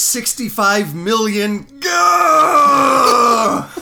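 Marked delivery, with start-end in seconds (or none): 1.8-3.7 a loud, higher, strangled "gah" of fake pain; mock anguished cry. Reviewer ends his score with a hammed-up loud pained yell. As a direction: cry out as if wounded.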